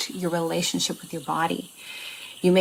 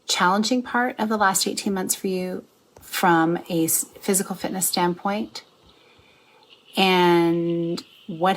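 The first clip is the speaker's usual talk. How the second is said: slow meditation guide with long pauses; guided meditation; slower than usual. Acted. Coach guiding listeners into a meditation, guided meditation. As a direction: ease listeners in slowly, phrase by phrase.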